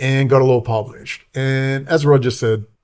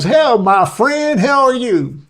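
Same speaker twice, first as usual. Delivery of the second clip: much higher, slow, put-on old man's greeting; Roger greeting; 12 semitones higher, 4 dB louder, much slower than usual. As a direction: drawl it out like an old friend. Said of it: "he goes".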